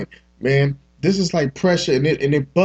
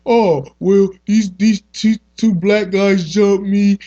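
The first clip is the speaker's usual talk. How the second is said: higher, louder, slow whiny put-on voice; mocking the Utah man; 5 semitones higher, 4 dB louder, slower than usual. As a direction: mimic a lying victim, drawn out and higher. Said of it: "This motherfucker gonna talk about".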